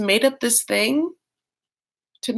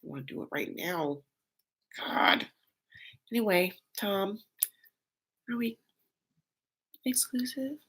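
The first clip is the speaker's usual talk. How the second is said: much softer breathy whisper; nervous whisper; 13 dB quieter than usual. Retold or imitated. Darcy nervously asking Tom, hushed whisper. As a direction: whisper it like a scared girlfriend. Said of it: "Darcy's like".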